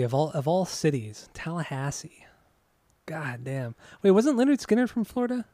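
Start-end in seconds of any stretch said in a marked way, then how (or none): 1.3-2.3 whispered, unvoiced Tallahassee. Real, not acted. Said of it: "But Tallahassee". 3.1-3.7 whispered. Real, not acted. Disgusted aside about Tallahassee.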